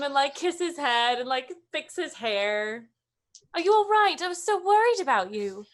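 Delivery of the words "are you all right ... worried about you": higher, fussing relief; worried parent. Acted. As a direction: gush with worry over a child just found. Parent fusses over returned child.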